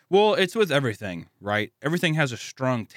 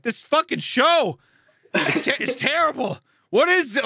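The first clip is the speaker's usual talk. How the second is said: retold outraged yelling; 13 semitones higher than usual. Husband ranting to wife about the show, acting outraged. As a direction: rant in comic outrage, voice shooting up high. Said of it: "yelling at my screen watching it".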